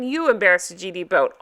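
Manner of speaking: ordinary delivery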